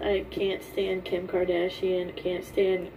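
usual speaking voice

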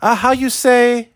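slow, high fake Spanish accent; mock Spanish accent. Mocking the wife's fake Spanish accent. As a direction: fumble like a fake Spanish speaker. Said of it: "pretending to be Spanish".

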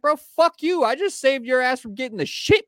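high, loud mock telling-off; the speaker re-enacting how he yelled at the clerk; retold yell. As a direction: yell it back at the clerk like you did then. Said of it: "I was like".

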